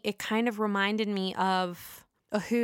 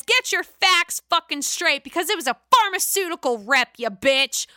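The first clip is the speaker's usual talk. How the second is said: higher, louder mock snapping; mock Jennifer fury; 7 semitones higher, 6 dB louder than usual. Jennifer correcting Margaret about the affair, loud mock fury. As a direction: bite back hard. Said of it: "Jennifer says".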